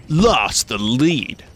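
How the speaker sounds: slow, drawn-out announcer boom; video game announcer. Retold or imitated. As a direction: stretch every word like an arena announcer. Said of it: "Being a video game announcer".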